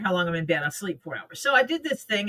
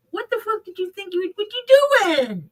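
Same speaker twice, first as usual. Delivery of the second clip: much higher, outraged squawk, louder at the end; outraged body voice; 12 semitones higher, 4 dB louder than usual. Retold or imitated. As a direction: play the body yelling back in a high put-on voice. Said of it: "And my body's like".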